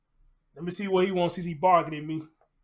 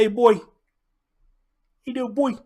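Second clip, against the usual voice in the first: higher and louder, eager greeting; cheerful call to puppy; 6 semitones higher, 8 dB louder than usual. Real, not acted. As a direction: call the pup brightly.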